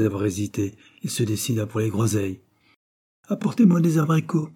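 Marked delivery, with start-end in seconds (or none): 3.3-4.5 calling out. A narrator's reading. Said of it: "cria Georges".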